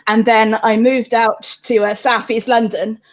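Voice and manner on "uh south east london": ordinary talk, nothing marked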